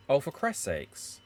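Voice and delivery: normal talking voice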